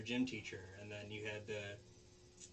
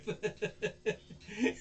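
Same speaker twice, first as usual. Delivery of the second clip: high burst of laughter; real cracking up; 11 semitones higher, 5 dB louder than usual. Real, not acted. Storyteller laughing at the grave burial, real cracking up. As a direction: laugh helplessly at the absurdity.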